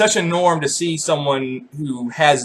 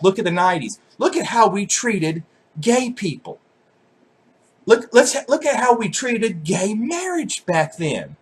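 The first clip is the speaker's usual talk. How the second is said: mock preachy regret; 4 semitones higher than usual. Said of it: "they're like".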